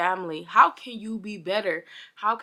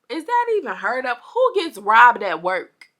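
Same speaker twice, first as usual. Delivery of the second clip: outraged; 5 semitones higher, 8 dB louder than usual. Real, not acted. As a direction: demand it of the world, fed up. Outraged at car break-in.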